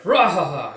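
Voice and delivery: loud belly laugh, rahaha; hearty booming laugh. Acted. Kuroku bursts in laughing loudly with his news. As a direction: laugh it out big and proud.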